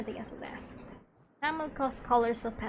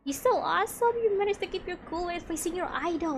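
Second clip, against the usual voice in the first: higher, louder gushing; praising a friend excitedly; excited praise; 7 semitones higher, 4 dB louder than usual. Acted. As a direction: gush over your friend's cool.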